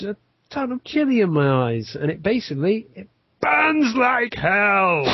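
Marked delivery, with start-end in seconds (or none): 2.9-5.0 slow, high, drawn-out wail; high-pitched howl of burning pain; dramatised agony. Retold or imitated. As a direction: stretch it out in mock agony. Dramatizing chili pain, measures clear.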